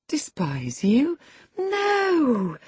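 slow then a sudden high cry of no; indignant girl crying out; shocked protest. Acted. Catherine protests.

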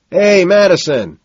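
loud, high hail; hollered hello; shouted greeting. A narrator's reading. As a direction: call out to him across the crowd. Greeting called out in club.

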